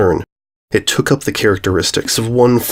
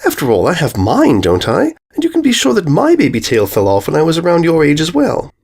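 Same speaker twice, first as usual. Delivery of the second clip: lightly raised refined lady voice; calm elegant sister; 6 semitones higher than usual. A narrator's reading. Big sister soothing the frightened filly, refined. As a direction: reassure her with poise.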